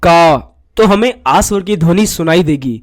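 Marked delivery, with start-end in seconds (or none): 0.0-0.4 का said clearly as ka, with a long aa; teacher sounds out का for the class; syllable demo. Shown as a demonstration. Teacher demonstrates का to pupils, clear and drawn out. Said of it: "अगर हम उच्चारण करें".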